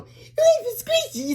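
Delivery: put-on high-pitched, drawn-out kids' shout; children shouting. A narrator's reading. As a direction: squeal it out slowly like excited children. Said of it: "the children shouted".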